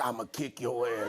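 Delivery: low, soft mock threat; menacing glare. Holyfield silently threatening Tyson across the arena, low and menacing. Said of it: "looking dead at Mike Tyson like".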